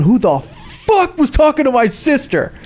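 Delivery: angry confrontation. Retold or imitated. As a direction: imitate an outraged guy demanding answers. Acting an angry stranger confronting them.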